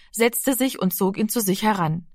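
ordinary delivery

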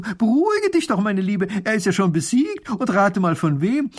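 high, loud call to calm her; calling out soothingly. A narrator's reading. Mathilde calls to the fleeing neighbour, loud and high. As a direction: shout reassurance across the garden. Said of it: "Mathilde ruft ihr zu".

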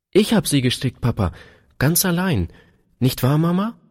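put-on high child's voice, rising on the appeal; proud little daughter. A narrator's reading. Daughter telling Papa she knitted them alone, proud high voice. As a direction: pitch it up like a proud small girl.

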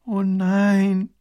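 high, loud, long dismayed groan; groaning princess. A narrator's reading. Princess groaning at the soldier's explanation, dismayed. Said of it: "stöhnt die Prinzessin".

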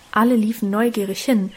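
ordinary delivery

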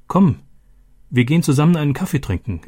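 eager warm invitation to celebrate; joyful invitation. Acted. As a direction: sweep him along with glad excitement. Roberto joyfully invites Nico.

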